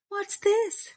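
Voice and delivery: high, curious question; a sudden high wondering question; surprised. Acted. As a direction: lift it way up in surprise. Farmer's wife finds a parcel.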